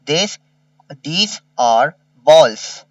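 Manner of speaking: these are balls, stretched out; very slow dictation. Shown as a demonstration. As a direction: dictate for copying. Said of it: "slow dictation read".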